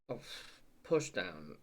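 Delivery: normal talking voice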